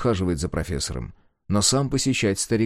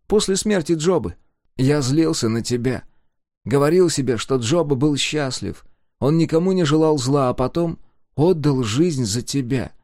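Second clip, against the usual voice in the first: put-on higher, earnest young man; 6 semitones higher, 4 dB louder than usual. A narrator's reading. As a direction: admit old anger, earnestly. Abel voiced, higher.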